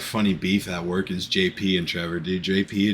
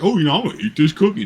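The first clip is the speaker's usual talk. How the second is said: higher, louder mock coworker; mock Caden; 9 semitones higher, 5 dB louder, faster than usual. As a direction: play it like a careless coworker. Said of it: "Caden literally was like".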